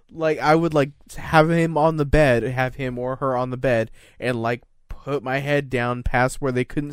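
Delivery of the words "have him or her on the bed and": normal talking voice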